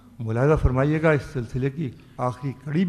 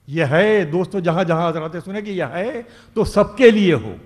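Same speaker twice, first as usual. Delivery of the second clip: declaiming a ghazal couplet; ghazal recitation; 5 dB louder than usual. Acted. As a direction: recite each line like poetry on stage. Poet recites verse at mushaira.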